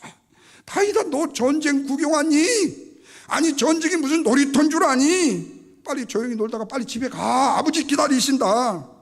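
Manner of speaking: high, loud scolding taunt; scornful shouted mockery, drawn out at the ends; jeering older brothers. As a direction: shout down a meddling little brother. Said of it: "이런 비아냥을 하잖아요".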